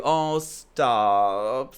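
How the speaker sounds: normal talking voice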